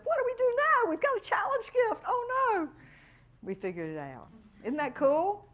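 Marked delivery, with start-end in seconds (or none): 0.0-2.7 much higher, louder, mock-panicked voice; panicked client. Client calls the coach in a mock panic, high and squealing. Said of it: "she said".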